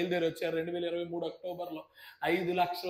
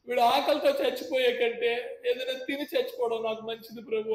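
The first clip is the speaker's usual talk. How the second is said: desperate prayer; 6 semitones higher, 5 dB louder than usual. Retold or imitated. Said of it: "నేను అనుకున్నాను, 'ప్రభువా".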